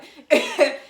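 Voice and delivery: quick, loud burst of laughter; short laugh. Real, not acted. Teller admitting she lied, laughing. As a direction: laugh at your own confession.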